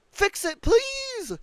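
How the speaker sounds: high, pleading please; high exasperated plea. Real, not acted. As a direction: beg the developer, voice jumping high. Begging the developer.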